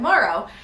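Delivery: Morrow with an a sound, like marrow; Frank's way. Retold or imitated. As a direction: say Morrow as mar-row. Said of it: "almost like it's supposed to be an A".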